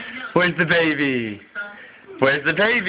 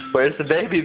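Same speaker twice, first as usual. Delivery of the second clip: usual speaking voice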